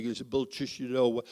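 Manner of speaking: low, soft blocked-nose mumble; mock a friend talking through a stuffy nose; lightly mimicked stuffed-up voice; imitating a bad cold. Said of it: "he talks like, like this".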